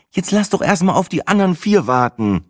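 high, a little irritated reply; slightly annoyed. A narrator's reading. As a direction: brush off his joke, mildly annoyed. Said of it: "erwiderte sie ein klein wenig genervt".

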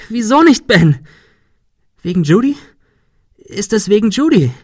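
high, loud questioning; shrieked accusation. Acted. Sarah demanding if it's about Judy, shrieking. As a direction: press for an answer at full pitch.

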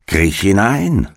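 lightly voiced wheedling witch; commanding witch. A narrator's reading. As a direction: lure her in with a wheedling command. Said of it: "sagte die Hexe".

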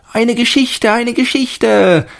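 put-on high, loud, drawn-out children's plea; children calling. A narrator's reading. Children beg the fat man for a story, loud high calling. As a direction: beg eagerly like excited kids. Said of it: "riefen die Kinder".

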